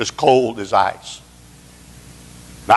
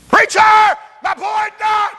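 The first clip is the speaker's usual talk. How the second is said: high, loud mock scream; mother's anguished scream; 4 dB louder than usual. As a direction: wail the news.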